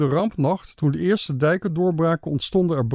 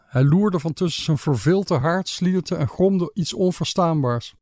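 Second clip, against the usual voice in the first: ordinary delivery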